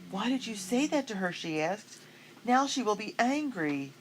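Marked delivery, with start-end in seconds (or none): 0.1-1.3 worried. A narrator's reading. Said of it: "Her blue eyes were troubled". 2.4-3.9 worried. A narrator's reading. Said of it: "Her blue eyes were troubled".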